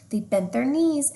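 usual speaking voice

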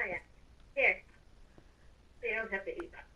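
usual speaking voice

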